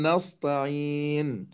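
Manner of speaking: "نستعين" with the س made emphatic like ص; نطق السين صادا خطأ; wrong heavy s. Shown as a demonstration. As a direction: thicken the s into a sad sound. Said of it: "أن يبدل السين صادا".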